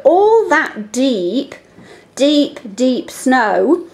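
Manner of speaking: slow, deliberate line; slow reading. Shown as a demonstration. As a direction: read it slowly so the E sounds are counted. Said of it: "How many times have you heard it now?".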